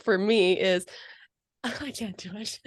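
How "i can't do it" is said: soft, giggly refusal; embarrassed laughing. Real, not acted. Owner asked to voice her dog, embarrassed laughing. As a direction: back out with an embarrassed little laugh.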